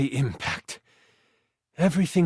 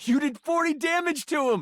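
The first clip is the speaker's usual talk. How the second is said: excited; 10 semitones higher than usual. Real, not acted. GM excited at big damage.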